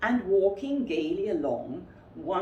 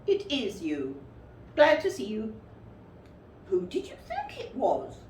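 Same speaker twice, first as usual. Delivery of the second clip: Rabbit's voice; 3 semitones higher than usual. Acted. Rabbit welcoming Pooh into his house, higher. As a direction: fuss over the guest.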